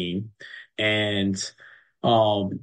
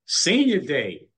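excited cheer; 4 semitones higher than usual. Real, not acted. Coach echoes the phrase in celebration.

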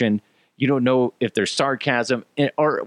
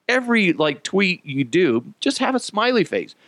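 ordinary delivery

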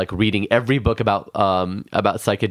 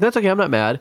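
put-on innocent child voice; 12 semitones higher, faster than usual. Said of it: "He's like".

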